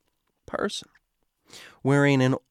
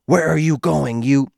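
angry baritone; 6 dB louder than usual. Acted. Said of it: "rose into an angry, the baritone state".

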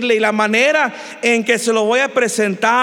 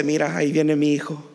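lower-pitched, gentle assurance; tender; 4 semitones lower than usual. Acted. God seeing his child come home, tender. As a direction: settle into calm fatherly love. God voiced as loving father.

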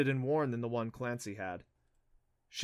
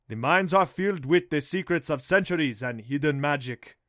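eerie cab driver words; 2 semitones higher, 8 dB louder than usual. Retold or imitated. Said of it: "repeating Jakuta's words".

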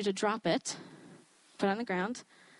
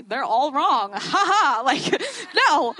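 loud, higher mock laugh and refusal; scoffing laughter; 5 semitones higher, 6 dB louder, slower than usual. Speaker retelling how she dismissed seven people's words, scoffing laughter. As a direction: laugh it off as absurd.

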